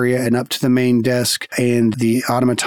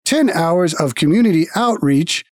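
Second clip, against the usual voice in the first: guild automaton; 5 semitones higher than usual. Acted. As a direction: speak like a polite machine at a desk.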